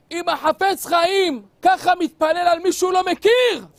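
loud, high amazed outburst; awed exclamation. Acted. Young Kahaneman exclaiming in wonder to himself, loud. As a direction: burst out in wonder.

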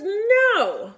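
high, loud little shout. Acted. Baby sister shouting at the dragon, loud high no. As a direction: belt out the baby's defiant no. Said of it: "seventh sister shouted her second word".

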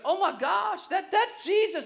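high, excited astonishment; acted-out gasp of excited surprise; amazed exclamation. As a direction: blurt it out in stunned recognition. Peter recognizes Jesus, acted out.

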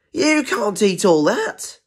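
high, slow objection; incredulous protest. Acted. Penguin warning Zebra about eating it all, incredulous protest. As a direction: protest it high, stretching each word.

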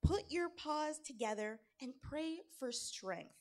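sly encouragement with lilting emphasis; coaxing. A narrator's reading. Anansi tricks climbing Tiger into praying, coaxing.

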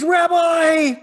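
high, loud joyful cry of recognition; excited cry. A narrator's reading. Mary recognizing Jesus at the tomb, joyful high cry. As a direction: cry out his title in overjoyed surprise. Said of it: "She just is so excited".

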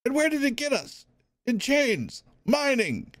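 bitter heckler. Acted. Prisoner bitterly accusing the wardens. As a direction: fling the grievance at him.

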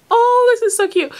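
high-pitched delighted coo; delighted gushing. Real, not acted. Vlogger admiring a fan's letter, delighted gushing.

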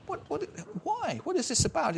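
high, bewildered mock outrage; a scandalised dinner host's disbelief, pitched high; incredulous what. Host's shocked incredulity, retold.

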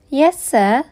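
polite. A narrator's reading. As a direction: answer an elder respectfully. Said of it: "said Oliver politely".

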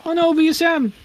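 mock panic. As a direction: ham up the alarm in a high voice. Mock panic over low health.

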